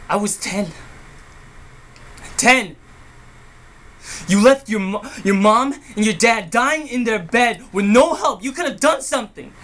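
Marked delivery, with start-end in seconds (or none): 2.4-9.5 loud, high-pitched angry accusation; anguished outburst. Acted. Sibling blames Charlie for leaving dying parents, anguished shouting. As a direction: hurl the blame at your brother, voice raised.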